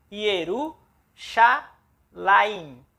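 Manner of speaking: Yerushalayim drawn out very slowly, ye-ru-sha-la-yim; Hebrew pronunciation. Shown as a demonstration. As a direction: stretch out each syllable of Yerushalayim. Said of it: "E aqui nós vamos ler". (Yerushalayim bem devagar, sílaba por sílaba)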